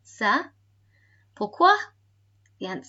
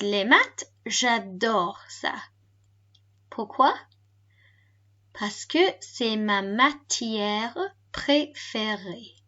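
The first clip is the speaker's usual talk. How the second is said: slow French, broken into small chunks; slower repeat. Shown as a demonstration. Teacher repeats a fast recorded answer, slowly. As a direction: stretch it out with pauses for learners. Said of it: "So quite a fast one there".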